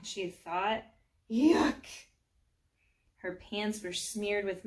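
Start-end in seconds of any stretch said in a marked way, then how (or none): 1.3-1.8 higher, louder cry of disgust. Acted. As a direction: recoil from something slimy. Disgust at falling in mud.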